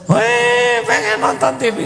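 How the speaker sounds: put-on high kid voice, whiny demand; demanding child. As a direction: whine for the TV like a small child.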